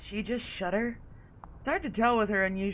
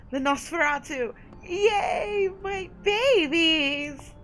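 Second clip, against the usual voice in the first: excited squeal; 8 semitones higher, 5 dB louder, much slower than usual. Real, not acted. Gushing over favourite clan.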